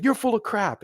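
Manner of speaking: high, scornful retort; retold boss scorn. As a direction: dismiss him with contempt. Colonel dismissing his excuse.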